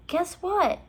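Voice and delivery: high, eager teasing question; high excited call. A narrator's reading. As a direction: share big news eagerly. Excited sister calling.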